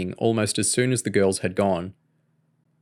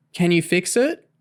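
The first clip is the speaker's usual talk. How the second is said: put-on little girl voice; 9 semitones higher than usual. A narrator's reading. Small child asks father.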